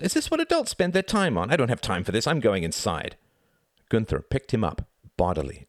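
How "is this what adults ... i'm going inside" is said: put-on high, indignant boy's voice; exasperated high-pitched tirade; crying out. A narrator's reading. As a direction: protest like a pompous, fed-up child. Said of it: "cried Reginald".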